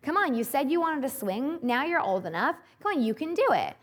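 high, loud, pushy urging; pushy mom goading. Retold or imitated. Mom goading her scared daughter up the tree, loud and pushy. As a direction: egg the child on up the ladder. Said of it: "She's like".

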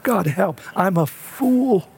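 high-pitched, anguished cry to God, re-enacted; retold weeping plea. Father recalling his prayer in the bathroom, re-enacted weeping plea. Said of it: "I am weeping, weeping, weeping".

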